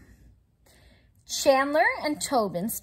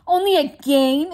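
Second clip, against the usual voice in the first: louder, indignant call from afar; called from shadows; 4 semitones higher, 8 dB louder than usual. Acted. Hidden chinchilla challenging the friends, indignant call. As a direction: call out incredulous from the dark. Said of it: "a voice called from the shadows".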